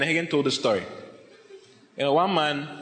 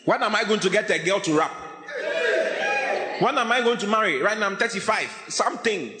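higher-pitched mock frustration; exasperated complaint; 7 semitones higher than usual. Single member fed up with church evenings, exasperated. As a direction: plead the grievance in a raised pitch.

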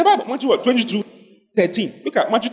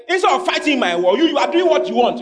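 usual speaking voice

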